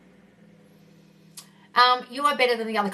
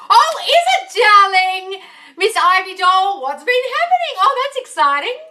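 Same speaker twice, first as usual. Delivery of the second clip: excited greeting; 9 semitones higher, 9 dB louder, slower than usual. Real, not acted. Greeting a viewer on live.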